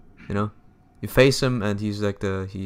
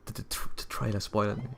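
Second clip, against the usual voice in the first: softer, muttered to himself; 10 dB quieter than usual. Real, not acted. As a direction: say it under your breath. Repeats remark, second softer.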